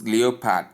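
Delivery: "leopard" spoken as lee-o-pard; mispronounced leopard. Shown as a demonstration. Teacher repeating the warning, leopard mispronounced lee-o-pard. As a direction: give "leopard" three full syllables. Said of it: "Not leopard".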